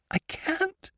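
very high, soft, strangled voice; choking cry. A narrator's reading. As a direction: choke out a distressed refusal. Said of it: "cried in a choking voice".

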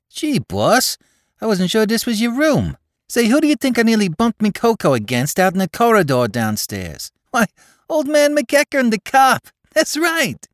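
put-on excited young New York tough; Bowery boy Spike. A narrator's reading. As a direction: rattle it off like a breathless Bowery lad. Spike voiced by narrator.

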